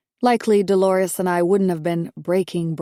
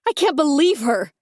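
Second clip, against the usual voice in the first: sharp, higher outraged cry; a hint of outraged wife; 7 semitones higher, 4 dB louder than usual. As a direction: blurt it out in disbelief. Heroine explodes at mother-in-law.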